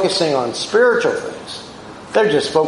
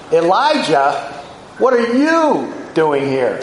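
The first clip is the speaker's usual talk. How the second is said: YOU stretched long and pitched up in "what are you doing here"; stress on YOU; slower than usual. Shown as a demonstration. God singles out Elijah of all people, stress on YOU. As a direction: lean slowly on the word YOU. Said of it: "what is he emphasizing".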